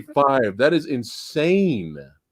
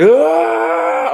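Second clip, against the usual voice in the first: high, loud drawn-out yell; raging monster scream; 6 dB louder than usual. Acted. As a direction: scream like an enraged movie monster. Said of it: "he starts screaming and rage and yelling".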